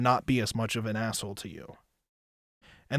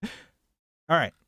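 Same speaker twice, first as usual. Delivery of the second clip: usual speaking voice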